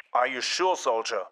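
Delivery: flat, even soldier monotone; a monotone clone officer reply. A narrator's reading. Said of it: "he replied with a monotone voice".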